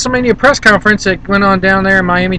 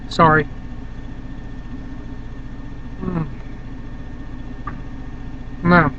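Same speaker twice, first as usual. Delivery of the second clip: mouth full; 3 semitones lower, 11 dB quieter than usual. Real, not acted. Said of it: "I hate talking with my mouth full".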